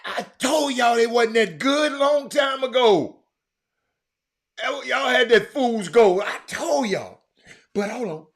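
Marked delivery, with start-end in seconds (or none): 0.0-7.2 told-you-so shout. Real, not acted. Streamer yelling to viewers that he warned them. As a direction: crow it at the whole chat like you called it. Gloating about Lakers being bad.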